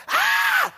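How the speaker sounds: sudden high, loud yelp of fright; a child's startled shriek as the belt comes down; scream of fright. Retold or imitated. Mother's belt coming down.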